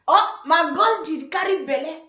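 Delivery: usual speaking voice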